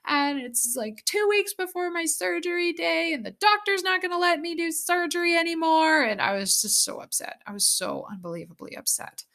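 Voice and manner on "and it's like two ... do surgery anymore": high, crying voice, retelling the call to her husband; bawling. Retold or imitated. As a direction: sob it out in a high, breaking voice. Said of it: "bawling my eyes out".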